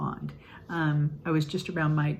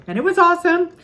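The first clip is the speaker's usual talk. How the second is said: excited; 11 semitones higher, 10 dB louder, faster than usual. Real, not acted. Teacher remembering a model student, thrilled. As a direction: burst out high and loud with delight. Delighted memory of a child.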